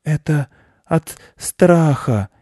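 high, slow, halting hare voice; weakened hare confessing fear; frightened hare. A narrator's reading. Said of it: "тихо прошептал заяц".

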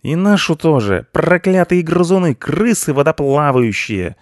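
high, loud cursing yell; furious cat. A narrator's reading. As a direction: hurl insults at full voice. (гневная громкая ругань)

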